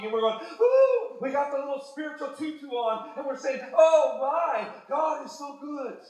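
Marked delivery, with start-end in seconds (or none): 0.6-1.2 mock swoon. 3.7-6.0 mocking a swooning worshipper. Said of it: "forgive me for mocking".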